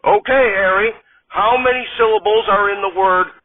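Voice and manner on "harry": ordinary delivery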